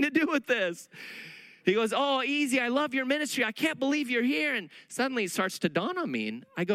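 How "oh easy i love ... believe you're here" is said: higher, eager fan voice; a touch of starstruck delight; gushing fan. Retold or imitated. The officer meets the speaker, higher and gushing.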